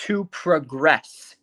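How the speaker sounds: TO PROGRESS slowly, with "progress" stressed on its last syllable; stress on second syllable. Shown as a demonstration. Teacher has class listen to the verb, "progress" stressed on GRESS. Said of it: "listen to the intonation of this verb".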